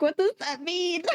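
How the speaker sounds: high, baffled question. Retold or imitated. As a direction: ask it in disbelief. Baffled inner reaction.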